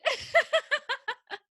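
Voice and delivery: loud, high laugh breaking out; high burst of laughter. Real, not acted. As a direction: crack up at her own joke. Laughs at twerk joke.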